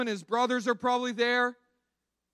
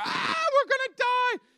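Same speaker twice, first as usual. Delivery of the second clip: much higher, loud frantic cry; panicked shout; 3 dB louder than usual. Retold or imitated. Disciples waking Jesus in the storm, panicked shout. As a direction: yell like the boat is sinking.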